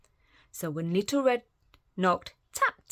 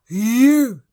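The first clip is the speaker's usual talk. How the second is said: sudden loud cry; spring it on her loudly; wolf crying out to Granny; 4 semitones higher, 12 dB louder than usual. Acted. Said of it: "cried the wolf".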